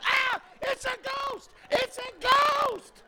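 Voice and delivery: high, slow, shouted ghost panic; hammed-up fright of grown men; mock terrified.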